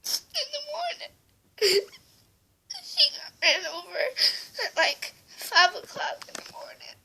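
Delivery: high, slow, tear-choked words; real crying. Real, not acted. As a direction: sob it out, barely holding on. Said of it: "so I started crying a little bit".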